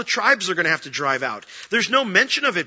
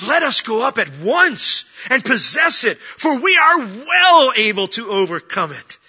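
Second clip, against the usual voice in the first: loud, emphatic rallying declaration; rally the crowd with full conviction; Caleb; 2 semitones higher, 4 dB louder than usual. Acted. Caleb to the people before Moses, bold and emphatic. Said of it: "he silences them".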